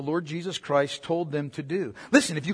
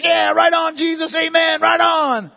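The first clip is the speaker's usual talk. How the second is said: higher, eager cheering of a fake follower; enthusiastic fake believer; 6 semitones higher than usual. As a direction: cheer him on like a hollow fan. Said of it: "giving affirmation to Jesus".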